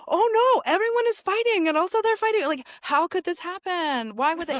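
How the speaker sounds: high, loud feigned shock; hammed-up innocent alarm; mock dismay. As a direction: wail in pretend bewilderment. Mocks British feigning surprise at fighting.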